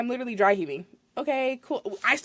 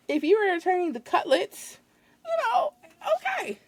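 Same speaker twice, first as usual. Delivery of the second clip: exasperated whine; 8 semitones higher than usual. Retold or imitated.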